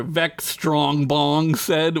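ordinary talk

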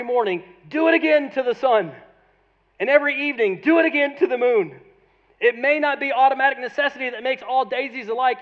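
loud, high, impassioned pulpit reading; emphatic quote reading. Acted. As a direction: read it out with mounting force.

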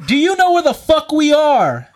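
loud, high indignant yell; confrontational. Retold or imitated. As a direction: throw the challenge right at him. Retold confrontation, repeating the challenge.

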